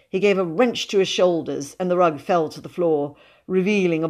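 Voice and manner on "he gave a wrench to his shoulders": usual speaking voice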